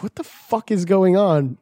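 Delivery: slow, slightly high, puzzled question; retold bafflement. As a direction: draw it out in mild disbelief. First baffled reaction in retold call.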